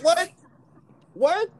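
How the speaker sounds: loud high mock outrage; shrill overlapping reality fight. Real, not acted. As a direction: yell What? up high.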